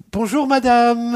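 very high, sing-song Bonjour, madame; high mock-polite French greeting; overly polite Parisian. Shown as a demonstration. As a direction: coo Bonjour, madame with exaggerated courtesy. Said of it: "the best would have been like".

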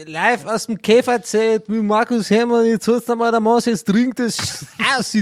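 high loud party chant; rowdy drinking-song cheer; boisterous beer tent. Acted. (ausgelassener Festzelt-Ruf)

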